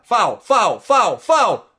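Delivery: high, loud repeated appeal; shouting for a foul. Real, not acted. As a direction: yell it at the referee.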